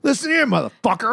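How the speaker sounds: high loud threatening voice; drunk tough guy. Pretend.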